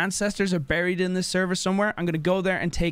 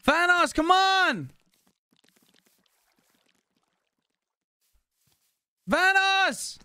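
calling out; 11 semitones higher, much slower than usual. Real, not acted. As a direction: shout his name across the map. Calling teammate during chase.

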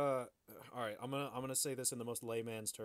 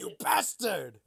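mock angry curse; 10 dB louder, slower than usual. Mock hotel keeper outburst.

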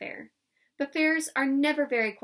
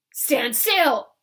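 much louder barked order; loud command; 3 semitones higher, 9 dB louder than usual. A narrator's reading. Laban ordering the crowd, loud command. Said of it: "said Laban".

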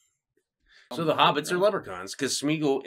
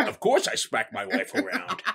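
Sean Connery; 4 semitones higher, 5 dB quieter than usual. Retold or imitated. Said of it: "Sean Connery's like".

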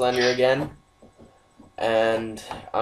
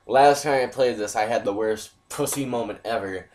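ordinary delivery

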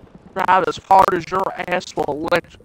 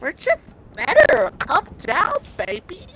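put-on teasing baby voice; 10 semitones higher than usual. Teasing a friend mid-race in high baby talk. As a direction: coo at him like a toddler.

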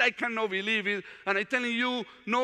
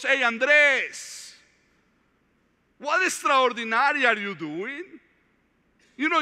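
louder and higher, calling himself out; self-rebuke; 5 semitones higher, 4 dB louder than usual. Acted. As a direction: scold yourself by name from the pulpit.

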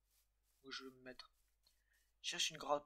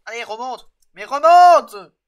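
building to a loud, slow panicked yell; desperate shout, rising in panic; 8 semitones higher, 31 dB louder, slower than usual. Real, not acted. Character falling, panic.

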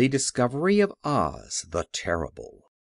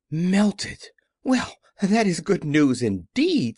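higher, mildly astonished reaction; surprised delight; 5 semitones higher, 3 dB louder, slower than usual. A narrator's reading. Gatekeeper hearing the witch is melted, surprised. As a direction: react with a touch of amazement.

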